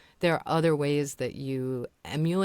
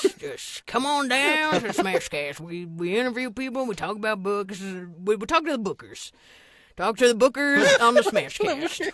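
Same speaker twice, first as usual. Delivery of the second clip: exaggerated put-on announcer, raised voice; mock promo character; 4 semitones higher, 4 dB louder than usual. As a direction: pitch it like a silly homespun advert. Improvising a folksy mock promo.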